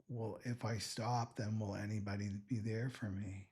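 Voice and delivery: usual speaking voice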